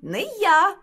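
high, loud mouse squeak; piping little mouse voice. A narrator's reading. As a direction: squeak out a refusal. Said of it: "пищить верть".

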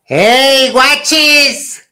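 calling out loud and high; aunt's high calling shout. Retold or imitated. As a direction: yell across to the river. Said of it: "Y grita".

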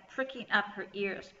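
ordinary delivery

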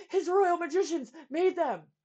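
breathless and loud; panting. A narrator's reading. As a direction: gasp it out. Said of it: "panted Bartholomew".

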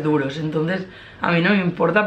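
speaking through fingers; slightly muffled behind hand; hand over mouth. Real, not acted. Seen 2: Left woman talks with fingers at her mouth from 1.2 to 3.0 s.

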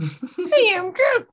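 put-on high, slow baby Groot squeak; very high drawn-out baby Groot. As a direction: draw it out high like a tiny tree. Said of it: "the baby one".

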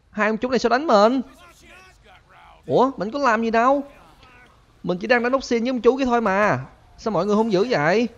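high-pitched, put-upon complaint; aggrieved protest. Pretend. Protesting to attackers in the game.

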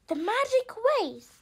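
usual speaking voice

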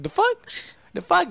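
high squeaky retold disbelief; retelling his own shocked reply; mock disbelief. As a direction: act out being baffled. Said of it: "I was like".

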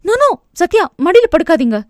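high, sudden alarmed cry to stop him; panicked protest. A narrator's reading. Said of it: "பதறி தடுத்தவளை". (பதற்றத்துடன் உயர்ந்த குரலில் தடுப்பது)

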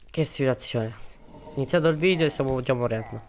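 ordinary delivery

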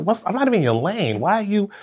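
high, fast, indignant complaint; exasperated shout. Retold or imitated. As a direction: call out an annoying driver. Retold yelling at honking driver.

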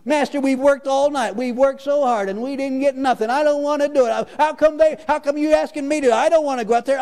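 high-pitched mock sulk; whiny Peter. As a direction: whine like a put-upon child dodging chores. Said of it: "wah, wah".

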